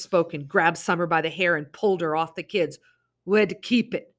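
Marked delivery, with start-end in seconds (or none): 3.2-4.1 menacing demand. Acted. Masked man interrogating.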